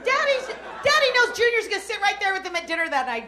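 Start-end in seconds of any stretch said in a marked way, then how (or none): none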